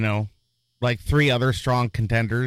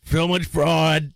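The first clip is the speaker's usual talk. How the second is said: higher, slow mock politician impression; Donald Trump; 5 semitones higher, slower than usual. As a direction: draw out the complaint in a put-on voice. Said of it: "Donald Trump telling him".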